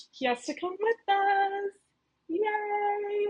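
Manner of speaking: high, drawn-out little cheer; sing-song, high-pitched delight; excited cheer. Acted. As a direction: lift it high into a playful yay. High cheer about a team member.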